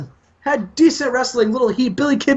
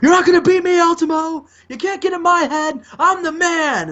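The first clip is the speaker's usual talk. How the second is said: higher-pitched mock taunt, half-yelled; 9 semitones higher than usual. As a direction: gloat at the rival, pitched way up. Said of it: "and he holds it up high and is like".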